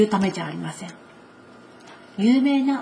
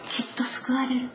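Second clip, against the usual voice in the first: murmured to herself; 3 semitones higher, 7 dB quieter than usual. Acted. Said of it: "言い続けたんです".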